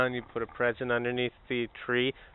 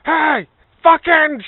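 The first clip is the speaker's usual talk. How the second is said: much higher, much louder yell; shout; 15 dB louder, much slower than usual. Pretend. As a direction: barge in yelling to wake him. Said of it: "You wake him up".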